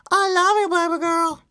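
squeaky pet-cuddling voice. Real, not acted. Baby talk to pet.

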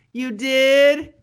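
high, loud, astonished question; mock astonishment. Gullible sister believing Tony's burial story, high mock surprise. Acting Janice's credulous reply.

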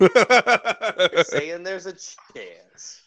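slow, hopeful movie-quote voice; Dumb and Dumber quote. Retold or imitated. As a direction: draw it out, dopey and hopeful.

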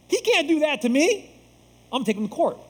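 indignant American. Retold or imitated. Pastor mimicking an American insisting on rights, in mock outrage. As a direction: puff up like someone guarding their rights. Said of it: "I don't know why I'm talking like that".

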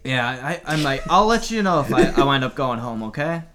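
low, deep male voice on radio; Fredericks voice. Acted. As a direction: drop low as Fredericks on walkie talkie. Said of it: "I have his voice".

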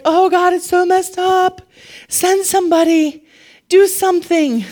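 high, drawn-out cry for help; mock desperate plea. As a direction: beg heaven like someone overwhelmed, hammed up. Acts a believer pleading with God.

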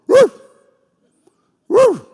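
sharp, high, loud woof; imitated dog bark. Dog at the couch needing to go out, high loud woofs. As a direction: yelp like a dog demanding attention. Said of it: "Pepper will stand in front of the couch".

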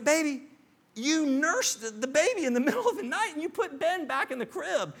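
high, fast, laughing reply to wife; amused husband answering his puzzled wife; amused laughing retort. Retold or imitated. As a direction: chuckle it out to her, quickly. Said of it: "And I was like".

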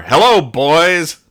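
hammed-up visitor greeting; mock greeting. Visitor busting through the door, slow loud hello.

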